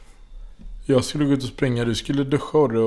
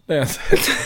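laughing; 12 semitones higher than usual. Real, not acted. Dad retelling the cashier's question, laughing.

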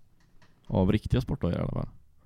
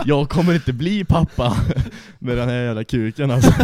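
laughing; 4 semitones higher, 8 dB louder than usual. Real, not acted. Laughing while telling injury.

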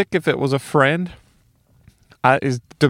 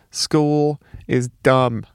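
slow defiant; much slower than usual. Real, not acted. Defiant verdict on school.

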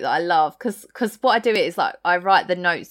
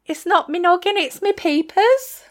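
high mock-English boy, slowing at the end; Bart's English accent; 11 semitones higher than usual. Co-host repeats favourite quote, high mock-English accent. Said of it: "Bart speaks in an English accent".

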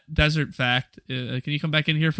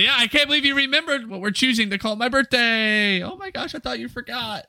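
high-pitched delighted squeal; gushing excitement; 10 semitones higher than usual. Pretend. Birthday guest thrilled the host remembered, gushing excitement. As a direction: gush with pitch sent way up.

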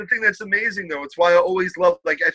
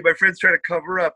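ordinary delivery

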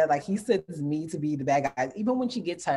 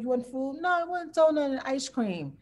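put-on high playful little-girl voice; imitating her daughter asking for donut and ice cream; toddler voice and parent; 9 semitones higher than usual. Mother voicing daughter in a high little voice. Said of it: "I'm like".

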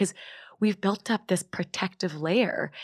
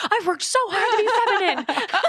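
much higher pitch, laughing through the words; 5 dB louder than usual. Real, not acted. Guest laughing at her own words to the host, high and giggly. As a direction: crack up at your own phrase.

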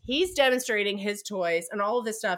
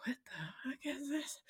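breathless whispered shock; 5 semitones higher, 17 dB quieter than usual. Retold or imitated. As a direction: hiss it so nobody hears. Clara sees marionette staff.

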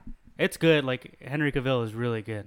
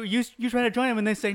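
ordinary talk, nothing marked

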